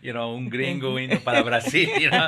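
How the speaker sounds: loud, laughing delight at the memory; laughing happily. Real, not acted. Retelling his move to Brazil, laughing loudly. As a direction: laugh it out, thrilled gringo off to Brazil. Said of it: "estaba súper feliz".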